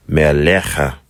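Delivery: Melecha with a throaty ch, stress on the last syllable; Hebrew pronunciation. Shown as a demonstration. Teacher models Melecha for students, throaty cha. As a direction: say Melecha slowly, rasping the cha. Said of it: "So it's a cha sound".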